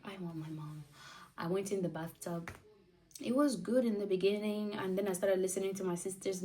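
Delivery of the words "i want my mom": soft whispered want for mom; whispered plea. Retold or imitated. Recalling labour, whispering for her mom. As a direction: hush it like a scared child.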